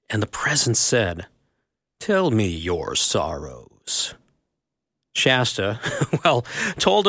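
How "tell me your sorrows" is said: low, slow and grave; deep lion voice. A narrator's reading. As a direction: speak softly as the great lion. The presence speaks, Aslan.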